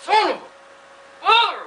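high, drawn-out cry of pain; pained yelp. Acted. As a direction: yelp it like you just got hurt. Hurts himself during the repair.

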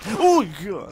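loud high yelp of alarm; real startled outburst; startled shout. Real, not acted. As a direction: cry out in sudden fright. Sudden danger in game.

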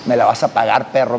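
low, growled threat from the angry wife; wife's threat. Retold or imitated. Angry wife threatening her husband, low and menacing. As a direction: menace him like a furious wife.

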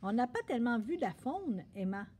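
ordinary delivery, nothing marked

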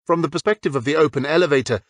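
ordinary delivery

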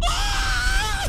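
long, very high loud scream; panicked scream. Real, not acted. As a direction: scream at full pitch in panic. Screams as enemy keeps coming.

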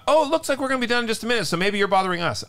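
high, quick, mock-sweet passive aggression; passive-aggressive. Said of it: "a very passive-aggressive voice that said".

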